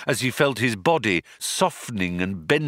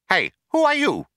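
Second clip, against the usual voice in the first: higher, louder penguin quack voice; 13 semitones higher, 4 dB louder, faster than usual. Acted. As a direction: quack it like a furious bird. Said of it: "he quacked".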